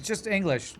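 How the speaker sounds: put-on English accent. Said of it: "people who are actually English, like".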